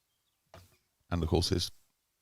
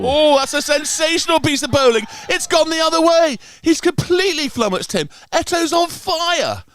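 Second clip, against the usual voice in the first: much higher and louder, thrilled; excited commentary shout; 9 dB louder than usual. Real, not acted. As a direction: yell it out as the stump is hit. Commentator sees a stunning wicket.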